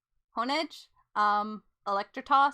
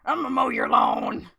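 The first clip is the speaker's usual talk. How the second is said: playful shout; 3 semitones higher, 9 dB louder than usual. Acted. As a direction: bellow it like a battle cry. Playing the cry as lawnmower.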